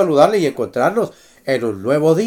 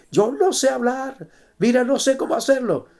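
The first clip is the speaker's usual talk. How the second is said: pleading Moses; 7 semitones higher than usual. Acted. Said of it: "Y Moisés dice".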